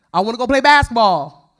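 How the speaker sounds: loud, emphatic tomboy protest; tomboy insisting. Retold or imitated. Tomboy tells her mom what she wants, loud insistence. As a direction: insist loudly like a sporty kid. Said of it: "Very tomboyish".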